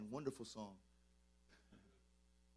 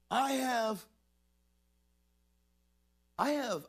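overwhelmed outburst; 9 semitones higher, 6 dB louder, slower than usual. Real, not acted. Emotional peak of preaching.

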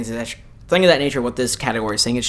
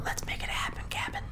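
whispering; 14 dB quieter than usual. Acted. Hushed hype before nominees.

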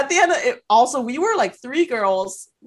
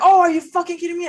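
angry yell; 8 semitones higher, 4 dB louder, much faster than usual. Retold or imitated. Friend yelling during bar fight.

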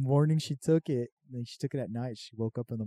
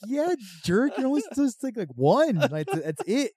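high, louder teasing scold; child mock-scolding mom about gummy dose; 5 semitones higher, 6 dB louder than usual. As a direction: lecture her like a know-it-all.

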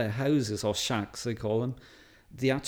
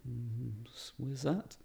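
puzzled quiet question; 12 dB quieter, slower than usual. Retold or imitated. Americans puzzled by Wales.

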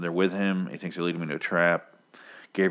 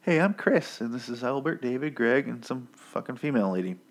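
lightly mocked polite introduction, higher voice; 5 semitones higher than usual. Cannibal leader introducing his group, mock politeness. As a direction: imitate an over-polite host, starting high. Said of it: "They're very polite. At least Chris is".

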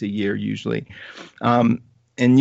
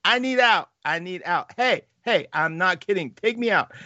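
panicked yelling; 7 semitones higher than usual. Retold or imitated. Rider begs the ride operator to stop, high panicky yell. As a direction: squeal to be let off the ride. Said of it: "I start yelling to the guy".

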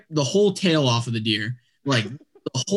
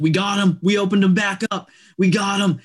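raised, higher mock yell; imitated yelling; 6 semitones higher than usual. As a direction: imitate her excited yell without full volume. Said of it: "She started yelling".

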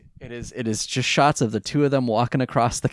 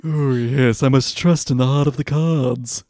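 loud, pompous posh villain; hammed-up theatrical voice; Maximilian Pegasus; 5 dB louder than usual. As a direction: declaim it like a cartoon mastermind. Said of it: "Maximilian Pegasus".

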